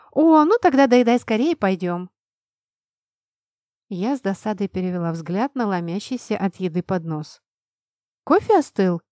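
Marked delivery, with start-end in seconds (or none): 0.1-2.1 loud bright and hurried; eager. A narrator's reading. Albina urges her to hurry. 8.2-9.0 high, loud curious; bright question. A narrator's reading. Albina asks brightly.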